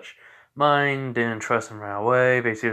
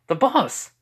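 much higher, louder exclamation; startled cry; 12 semitones higher, 4 dB louder than usual. Acted. Girl suddenly seeing the boss, startled cry. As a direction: blurt it out in shock.

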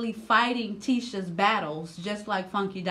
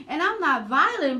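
usual speaking voice

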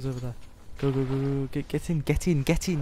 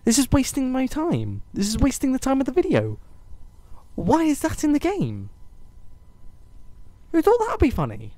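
high, raised-voice complaint; indignant rant; 6 semitones higher, 3 dB louder than usual. Real, not acted. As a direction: rant at whoever made this game. Ranting at a game's design.

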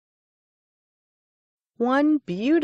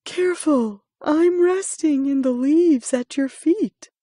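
drowsy exclaim; 7 semitones higher, 4 dB louder than usual. A narrator's reading. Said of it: "drowsy voice exclaimed".

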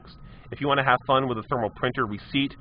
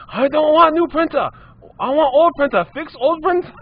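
much higher and louder, stubborn demand; high shrill customer; 9 dB louder than usual. Retold or imitated. Customer voiced, still insisting.

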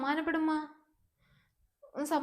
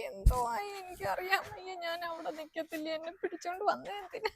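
tearful, softer pleading through tears; crying; 3 semitones higher, 4 dB quieter than usual. Acted. Mother frets over her daughter left alone, tearful and softer. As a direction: weep while you protest. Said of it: "നീ കരയണ്ട".

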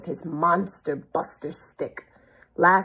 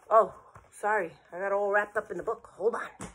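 plain reading voice

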